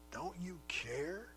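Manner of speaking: a sister's put-upon, needling complaint; condescending. Acted. Martha complaining to Jesus, condescending and reproachful. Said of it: "condescending tone in her voice".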